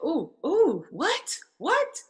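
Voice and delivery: rising disbelief, acting out a shocked best friend; mock shocked friend. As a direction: play the friend catching on in disbelief. Voicing friend's shocked discernment.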